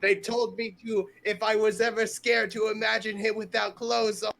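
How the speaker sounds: loud, high, hammed-up protest; high-pitched confession. Character confessing what they were told to imagine, high blurt.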